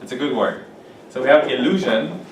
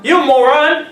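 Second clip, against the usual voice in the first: sharply higher, louder "You moron"; mock insult; 11 semitones higher, 6 dB louder, slower than usual. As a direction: yell it at a silly friend. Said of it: "it's kinda rude".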